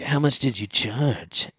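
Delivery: the boss leering at her over her past, slow; leering question. Retold or imitated. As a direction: drawl the question like a creep. Creep asks her price.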